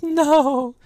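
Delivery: a very high, stretched no; high mock wail. Girl wailing again over the body, a high hammed-up no.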